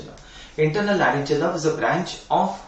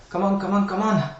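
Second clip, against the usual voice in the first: higher-pitched, insistent prodding; urging; 6 semitones higher, 4 dB louder than usual. Real, not acted. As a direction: urge the students to answer. Teacher prods students for answer.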